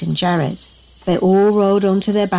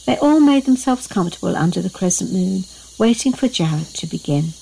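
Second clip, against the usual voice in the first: ordinary delivery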